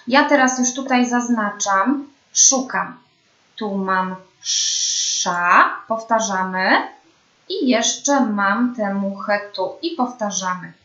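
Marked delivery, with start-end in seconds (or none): 4.4-5.8 "sza" with a long hushing sz before the vowel; drawn-out SZ. Shown as a demonstration. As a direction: model the syllable "sza", sz held long. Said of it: "Pamiętamy język wysoko".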